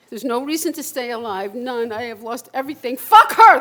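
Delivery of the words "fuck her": sudden loud, high curse; furious outburst. Acted. Alone on the toilet seat cursing his ex, furious shout. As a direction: explode at her out of nowhere. Acted rage at leaving girlfriend.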